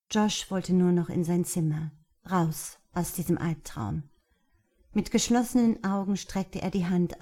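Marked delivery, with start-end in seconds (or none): none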